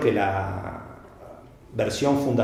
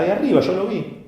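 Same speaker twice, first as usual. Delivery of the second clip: much higher pitched, triumphant; sure spectator; 8 semitones higher than usual. Retold or imitated. Spectator tells the magician he saw the move, high and triumphant. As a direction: squeak it out, proud to have caught him. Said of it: "¿Pero dónde está la carta?".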